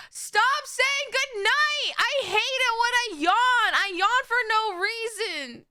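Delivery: high, drawn-out playful wail; mock whining protest. Real, not acted. Streamer telling viewers off over yawning, exasperated high whine. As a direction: whine it at them, stretching the words.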